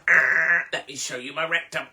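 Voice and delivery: loud lewd groan then leer; mock lewd groan. To a stranger, trying to be sexy, hammed-up lewd groan. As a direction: groan it like a seedy come-on. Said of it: "it wouldn't be like".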